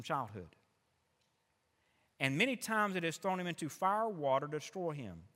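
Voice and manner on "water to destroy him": ordinary talk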